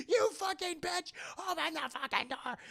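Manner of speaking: hammed-up desperate rage; mock furious yell. Trapped woman yells through door.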